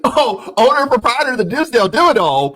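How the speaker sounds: high, loud, fast tycoon voice; Doug Dimmadome. Pretend. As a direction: boast it like a cartoon mogul. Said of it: "It's giving Doug Dimmadome".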